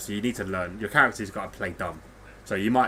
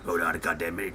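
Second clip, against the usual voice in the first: lower, lightly gruff echo of his character voice; mock imitation; 5 semitones lower, 3 dB quieter than usual. Mocking a friend's game voice, gruffer and lower. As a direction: throw his own line back at him in a gruffer voice. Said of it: "Take the piss out of me".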